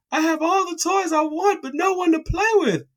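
put-on very high sobbing kid voice; imitating crying child. Sad boy telling his mom about no playmates, high mock sob. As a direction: wail it like a sad little boy.

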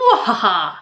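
low, gleeful villain cackle; mad scientist. Pretend. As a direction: cackle like an evil genius. [name] locked in her dungeon.

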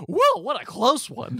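high, drawn-out cartoon dog; talking dog. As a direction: stretch it out in a high goofy dog voice. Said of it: "and the dog says".

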